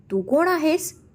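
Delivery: high, puzzled ask; surprised question. A narrator's reading. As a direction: jump up in pitch, caught off guard. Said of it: "दीपने आश्चर्याने विचारलं".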